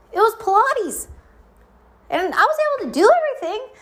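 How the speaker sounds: exasperated complaint, voice climbing high; heated rant. Real, not acted. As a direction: vent about the never-satisfied instructor. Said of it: "ranting about instructor".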